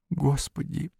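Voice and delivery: high, soft plaintive wail; whiny, tearful. A narrator's reading. Beatrice suddenly despairs, whining tearfully. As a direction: whimper it high and softly. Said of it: "сказала она вдруг плаксиво".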